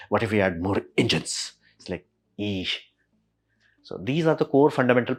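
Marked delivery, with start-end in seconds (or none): 2.4-2.7 low, soft wincing eesh; a quiet disgusted eesh; cringing eesh. Real, not acted. Reacting to more engines.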